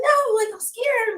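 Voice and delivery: slow, high, mock-timid whine; high scared voice. Newcomer confessing fear to a buddy, high timid voice. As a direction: play the nervous beginner, high and slow.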